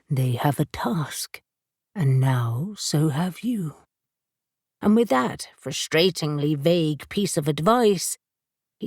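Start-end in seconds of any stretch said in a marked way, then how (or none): 0.1-3.8 slow, low and otherworldly; lower, slow spirit voice. A narrator's reading. Hades warns Birdie, slow and low. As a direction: drag it out like an ancient spirit.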